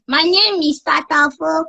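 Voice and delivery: put-on high childish voice, proud; a little kid introducing herself; baby voice. Child character giving her name to caller. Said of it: "just use that baby voice".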